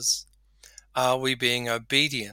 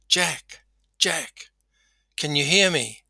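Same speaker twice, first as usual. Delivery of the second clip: raised-pitch call to someone far off; 3 semitones higher than usual. Acted. A voice calls down to man on cliff, calling out. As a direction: call his name, checking he can hear.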